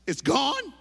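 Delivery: high, loud incredulous question; can't believe the plane left; disbelief. Retold or imitated. To the counter lady, high disbelieving. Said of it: "I said".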